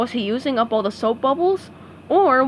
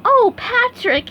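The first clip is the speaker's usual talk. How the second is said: smiling; 12 semitones higher, 5 dB louder, slower than usual. A narrator's reading. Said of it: "SpongeBob smiled".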